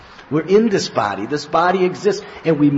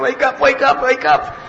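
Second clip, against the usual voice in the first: high, louder shouted call; calling to wake; 10 semitones higher, 5 dB louder than usual. Retold or imitated. Devotees shouting to wake the dreamer. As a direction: shout him awake urgently.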